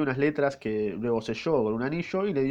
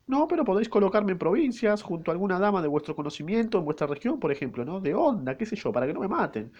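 put-on higher falsetto young maid, anxious; lightly falsetto maid pleading for safety; 7 semitones higher than usual. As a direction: ask for a post in a small high voice. Maid asks to be placed.

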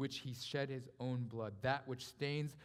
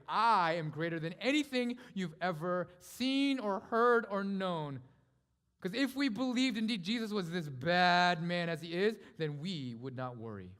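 impassioned preaching; 2 semitones higher, 6 dB louder, slower than usual. Acted. Preacher proclaims Jesus' greatness to worriers, impassioned. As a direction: thunder out the assurance.

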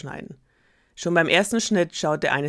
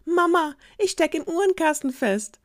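put-on much higher small kid voice calling out; little kid calling; 12 semitones higher, 5 dB louder than usual. Youngest goat calling mama from the clock case, high. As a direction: call for help from hiding.

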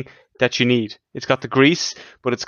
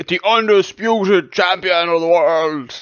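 mock announcer; 4 semitones higher, slower than usual. Mock-crowning his co-host champion.

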